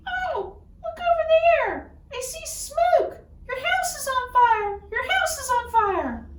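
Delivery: a sly little leprechaun crying fire to distract him; high leprechaun pointing, pretending panic with a laugh; tricky leprechaun.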